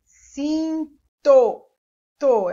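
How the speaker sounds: "Cinto" with held "cin", pause, loud "to"; sounding out syllables. Shown as a demonstration. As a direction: isolate the last syllable of "Cinto". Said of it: "Preste atenção na próxima sílaba". ("Cinto" separado, destacando o "to")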